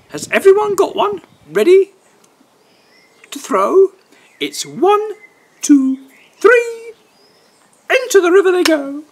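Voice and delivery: put-on high, loud little girl voice; gleeful girl. Girl counting down the race, high excited cheer. Girl playing pooh sticks.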